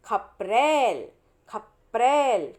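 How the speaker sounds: खपरेल drawn out slowly syllable by syllable; slow syllable reading. Shown as a demonstration. Teacher sounding out खपरेल for young pupils, slowly. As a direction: spell out खपरेल slowly as kha-pa-rel. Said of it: "खपरेल क्या होता है?".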